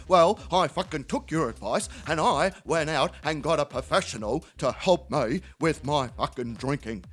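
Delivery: low, halting drinking-mate voice; Terry. Acted. Drinking mate approving of getting help, low halting voice. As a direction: drop the pitch and space out the words. Acts Terry reporting his news.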